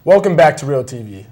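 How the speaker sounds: big announcer welcome, projected; loud show welcome. Real, not acted. TV host greets viewers loudly.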